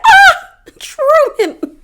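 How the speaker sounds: very high, dismayed shriek of a name; mock scream. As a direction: cry out in horrified disappointment, half laughing. Dismayed outcry at show's relapse.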